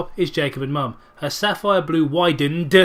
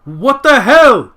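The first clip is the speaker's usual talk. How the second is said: slow, much higher, loud; shocked yell; 9 semitones higher, 13 dB louder, much slower than usual. Acted. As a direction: yell it, appalled. Sonia catching them.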